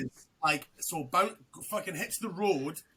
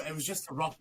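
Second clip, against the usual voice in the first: ordinary delivery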